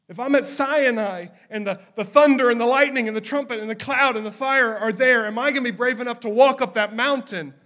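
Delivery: loud, heated preacher's cry; full-force preaching. Acted. Preacher stirring listeners about Sinai, loud and fervent. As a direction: thunder it out like a revival preacher.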